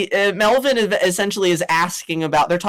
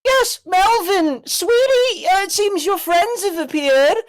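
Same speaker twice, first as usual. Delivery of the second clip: high sweet hen voice; 10 semitones higher than usual. Acted. Chicken lady greets Melvin.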